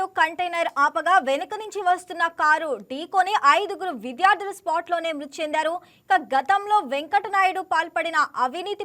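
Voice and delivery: punchy, sing-song news anchor delivery; breaking news read. Acted. Anchor reads breaking news bulletin. (Breaking news చదివే శైలి)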